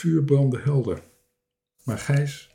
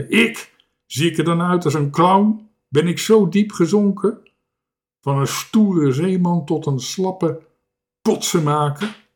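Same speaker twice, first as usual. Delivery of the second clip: loud, raised, affronted voice; indignant; 6 semitones higher, 4 dB louder than usual. A narrator's reading. Proud seaman mistaken for a clown, indignant. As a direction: protest with wounded pride. Offended man taken for clown.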